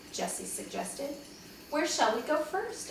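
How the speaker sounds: ordinary delivery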